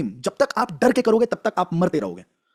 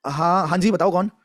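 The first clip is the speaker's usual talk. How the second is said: normal talking voice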